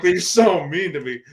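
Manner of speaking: high, loud whiny put-on voice; whiny complaint. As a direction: whine like a hurt kid. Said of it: "I was like".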